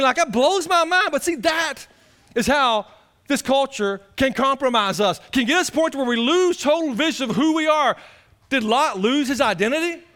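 loud, high preacher's cry; full-force preaching. Acted. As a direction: hammer the point to the church.

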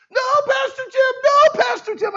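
put-on very high, loud children's scream; kids screaming. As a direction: shriek it out like panicked kids. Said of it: "the kids start screaming".

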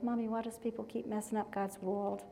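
soft, lightly imitated small child; sweet little-boy voice. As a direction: quietly quote a little son's puzzled question. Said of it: "how he would say world".

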